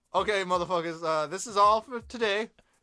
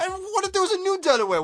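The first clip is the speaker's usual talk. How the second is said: high, louder mock-dramatic question; jokey high-pitched what-if; 10 semitones higher, 4 dB louder than usual. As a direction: pose it like a wild revelation.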